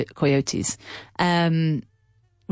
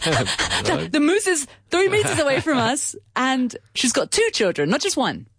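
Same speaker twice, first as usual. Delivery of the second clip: higher, rushed alarm; 7 semitones higher than usual. Retold or imitated. Mother realising the moose is close, higher alarmed voice. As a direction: voice it as panic rising. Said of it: "I was like".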